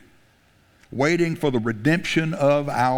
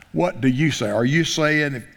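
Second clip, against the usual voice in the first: usual speaking voice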